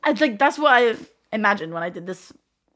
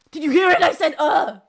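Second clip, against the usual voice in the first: excited; 6 semitones higher, 6 dB louder than usual. Real, not acted. Excited about her own sound.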